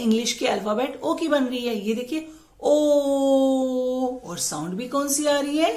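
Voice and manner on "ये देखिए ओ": ओ held long and round, very slowly; slow o demo. Shown as a demonstration. Teacher showing mouth shape for kids, slow and drawn out. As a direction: stretch ओ out for them to watch. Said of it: "उसकी shape क्या बन रही है".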